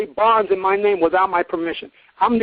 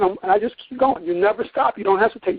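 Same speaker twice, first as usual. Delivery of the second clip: ordinary delivery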